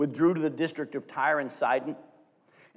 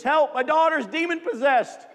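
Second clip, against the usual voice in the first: much higher and louder cry for help; desperate calling out; 9 semitones higher, 6 dB louder than usual. Retold or imitated. Mother calling to Jesus for her daughter, desperate cry. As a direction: call out like a frantic mother.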